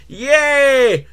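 high, loud drawn-out yay; mock cheer. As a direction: cheer with put-on glee.

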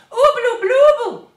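high, very loud alarmed gummed-up cry. A narrator's reading. Moonface grabbing Jo in alarm, toffee-muffled cry. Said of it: "he cried".

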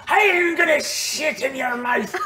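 high, slow, hammed-up villain; pirate threat. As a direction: drag out the threat in character. Game master voices pirate foe's threat.

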